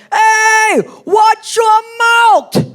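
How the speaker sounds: high, loud New York shout; scolding New York accent. Retold or imitated. Boyfriend jumping on her for cursing, shouted New York accent. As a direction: jump on someone in thick New York voice. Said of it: "jump on me for cursing".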